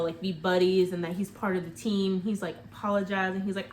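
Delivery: ordinary delivery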